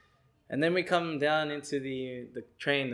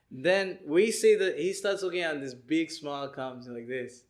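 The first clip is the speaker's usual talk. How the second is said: plain reading voice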